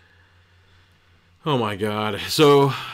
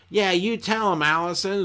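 high-pitched mock gal pal; supportive girlfriend; 9 semitones higher than usual. Friend backing Allison's breakup plan, high mock cheerleading.

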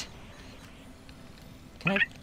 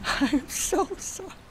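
much higher, slow, laughing through it; laughing apology; much slower than usual. Real, not acted. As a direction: giggle the apology out.

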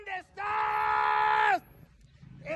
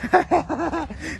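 laugh. Real, not acted. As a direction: burst of cackling laughter. Laughs at the friend's answer.

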